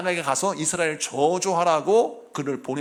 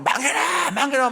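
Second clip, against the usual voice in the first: mock shaman curse; 8 semitones higher than usual. Imitating a shaman's curse chant.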